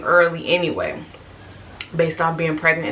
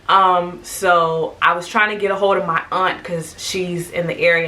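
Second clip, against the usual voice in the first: ordinary talk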